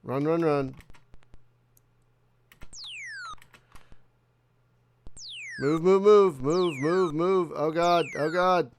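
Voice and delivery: panicked yelling while fleeing; frantic, loud, urgent; being chased. Real, not acted. Fleeing in combat, no weapons.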